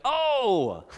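a sudden high "Oh!" of dawning; mock surprise. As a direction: act out the disciples catching on. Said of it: "his disciples are like".

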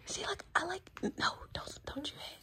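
whisper. Real, not acted. Hushing the boy near the baby.

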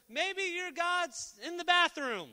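high, slow mocking jab; sarcastic taunt. Elijah taunting the prophets about their god, high slow sarcasm. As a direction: tease them with fake concern.

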